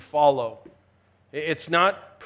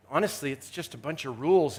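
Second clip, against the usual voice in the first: ordinary talk, nothing marked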